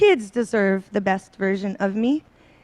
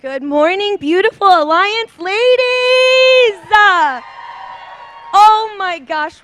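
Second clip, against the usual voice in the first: much higher and louder, stretched slowly over the crowd; excited greeting; 9 semitones higher, 6 dB louder, slower than usual. Real, not acted. The new speaker gushes a welcome to the Alliance ladies, high, loud and drawn out. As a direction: gush a greeting to the room, long and bright.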